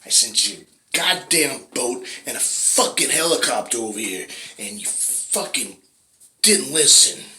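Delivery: loud, slowed, hammering rant; furious God. Acted. God listing the refused rescues to the drowned man, loud and slow. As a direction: list every rescue he refused.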